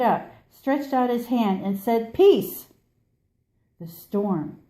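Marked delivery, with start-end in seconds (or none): 2.1-2.7 high, loud, ringing command; commanding cry. Acted. The tired traveler commands the storm, ringing loud. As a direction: still the storm with one word.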